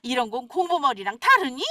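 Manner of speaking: high-pitched, indignant boasting about her son; exasperated mother-in-law. Acted. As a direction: lament that he deserves better. (아들이 아까워 분통 터진 시어머니)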